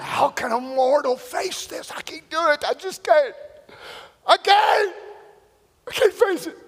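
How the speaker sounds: acted panic climbing high; rising desperate protest; overwhelmed dread. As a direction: build from doubt to a high cry of helplessness. Jesus imagining the cross, acted.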